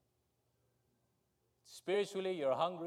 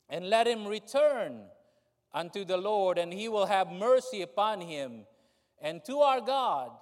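preaching scripture; 3 semitones higher, 8 dB louder than usual. Acted.

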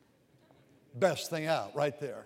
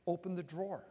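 gentle inner prompting; 8 dB quieter than usual. Retold or imitated. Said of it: "the Spirit of God said".

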